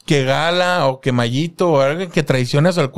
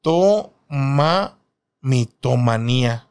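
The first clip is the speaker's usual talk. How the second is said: slow sounding out; 3 semitones lower, much slower than usual. Shown as a demonstration. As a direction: slowly sound out mitomanía. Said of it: "acento en la primera".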